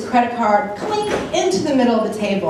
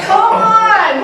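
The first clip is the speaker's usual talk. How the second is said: high loud exasperated cry; embarrassed outburst; 10 semitones higher, 5 dB louder than usual. Retold or imitated. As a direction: blurt it out, mortified. Caught out, embarrassed.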